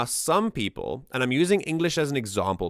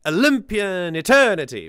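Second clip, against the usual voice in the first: "Olympian eternity" with a louder push on "eternity"; stress shown; 7 semitones higher, 6 dB louder than usual. Shown as a demonstration. A music reviewer showing where the lyric stress falls, louder. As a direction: lean into "eternity". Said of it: "that's where the emphasis is".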